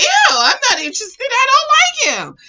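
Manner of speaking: put-on high, loud teenage "ew" outburst; disgusted rejection. Girl rejecting the boy who loved her, loudly disgusted. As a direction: squeal it in hammed-up disgust.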